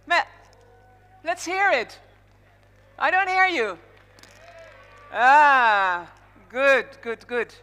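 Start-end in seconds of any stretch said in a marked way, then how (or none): none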